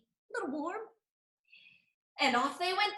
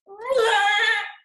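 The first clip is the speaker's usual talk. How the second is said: loud, high gasp of shock; 5 semitones higher, 11 dB louder than usual. Acted. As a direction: gasp at the half sun. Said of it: "Bleated the Goat".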